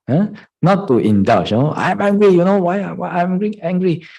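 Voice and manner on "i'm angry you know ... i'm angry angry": high, loud mock indignation; hammed-up angry demand; mock indignant. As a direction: rant like a self-righteous student. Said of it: "not like that".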